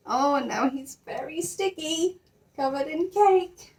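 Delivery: high, slow, teasing sympathy; sing-song pretend pity; mock sympathy. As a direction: tut over the sticky mess, playfully. Mock pity over sticky bear.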